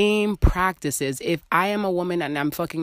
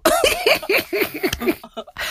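long high cackle; shrieking laugh at her own joke. Real, not acted. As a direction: burst out laughing.

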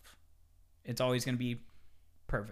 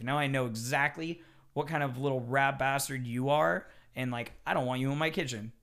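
ordinary talk

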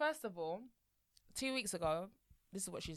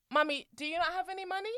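put-on higher, curious little girl; high child voice; 7 semitones higher than usual. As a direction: ask like a small child. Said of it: "she goes".

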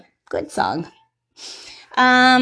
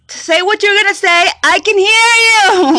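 mock annoyed yell, higher and louder; imitating her yelling; 8 semitones higher, 6 dB louder than usual. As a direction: snap it back with attitude. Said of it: "she goes".

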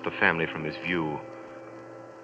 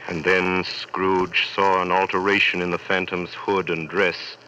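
ordinary talk

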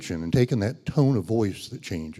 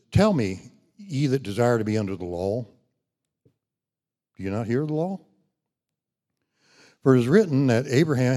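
louder, raised reading voice; scripture reading; 3 semitones higher, 5 dB louder than usual. Acted. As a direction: proclaim the verses. Scripture read with force.

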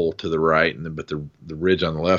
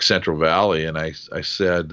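ordinary delivery

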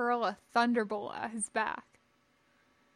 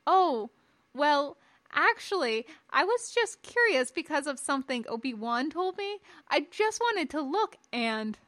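put-on higher, hesitant boyish excuse; sheepish young Padawan; 5 semitones higher, 3 dB louder than usual. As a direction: explain yourself awkwardly to your master.

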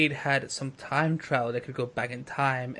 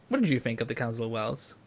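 usual speaking voice

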